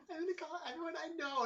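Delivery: very high-pitched mock whine; whining. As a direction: whine it like a dramatic teenager. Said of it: "like, whining".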